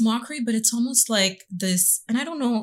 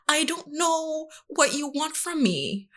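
put-on pleading child; 9 semitones higher than usual.

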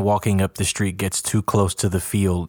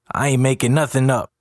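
higher, insistent protest; understated yelled objection; mild yell; 5 semitones higher than usual. A narrator's reading. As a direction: protest that you are telling the truth. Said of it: "yells Jabri".

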